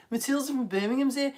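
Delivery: playful, badly done Birmingham accent. Teacher teasing Matilda from Birmingham, mock Birmingham accent. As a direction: lightly put on a Brummie accent. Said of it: "loves it when I do the accent".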